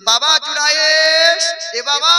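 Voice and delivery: high, loud, slow call of the name. Acted. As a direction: shout it through the closed door. Mother calls son second day.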